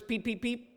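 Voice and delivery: high little peeps; peeper frog call. Shown as a demonstration. Leader showing the peepers group, high peeping. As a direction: peep like spring frogs. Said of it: "Can you be my peepers".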